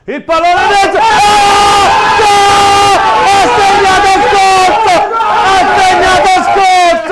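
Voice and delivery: roaring goal call, high and loud; breathless italian goal commentary. Real, not acted. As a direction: scream the goal like a stadium announcer. Goal scored, commentator erupts.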